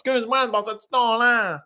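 high, garbled Saguenay whine; plaintive Saguenay accent. Retold or imitated. Lady repeats her request for milk, plaintive Saguenay accent. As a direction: mimic a little lady's whiny Saguenay accent. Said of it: "tellement comme plaignant".

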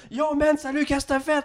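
high-pitched mock buddy greeting; high, excited retold hail. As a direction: imitate an over-eager hello, way up high. Mimics their own excited greeting.

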